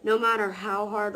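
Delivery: ordinary talk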